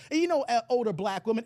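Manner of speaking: ordinary talk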